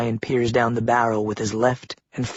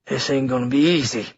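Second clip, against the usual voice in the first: grumbling. A narrator's reading. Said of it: "Philip grumbles".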